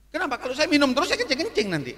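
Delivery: tinggi dan cepat; bantahan jenaka. Retold or imitated. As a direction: berkilah dengan nada dibuat melengking. Membantah manajer secara jenaka. (bantahan jenaka bernada tinggi)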